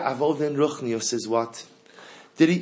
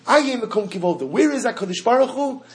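higher, calling out Aye Mekom Kivodo as a plea; searching question; 10 semitones higher, 4 dB louder than usual. Shown as a demonstration. Teacher voicing the seeker's cry, higher and louder. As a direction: pitch it up, searching for God. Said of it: "The concept, Aye".

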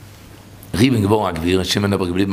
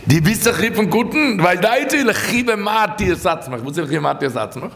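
high-pitched urgent sermon delivery; impassioned preaching; 9 semitones higher than usual. Real, not acted. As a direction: preach it high, driving the point home. (פייערדיגע דרשה, הויך און דרינגענד)

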